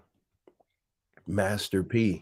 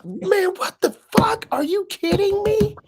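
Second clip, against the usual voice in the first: mock outrage; 5 dB louder than usual.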